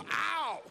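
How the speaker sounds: sharp high ow; mock yelp. Boy swatted by his mother, mock yelp.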